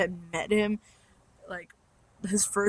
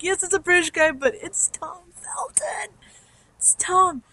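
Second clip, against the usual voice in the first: much higher, giddy fan voice; excited fangirl reply; 10 semitones higher than usual. Retold or imitated. Fans answering onlookers, high excited gushing. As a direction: gush back at the teasers. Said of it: "We're like".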